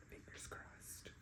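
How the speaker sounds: barely audible, slow whisper; conspiratorial whisper. Real, not acted. Reader secretly hoping Doug dies, whispered. Wishing a character dead, under breath.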